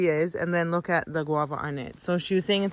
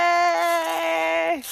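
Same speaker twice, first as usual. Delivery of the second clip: high, loud cheer; excited cheer; 9 semitones higher, 5 dB louder than usual. Real, not acted. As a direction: celebrate the catch. Cheers at picking fruit.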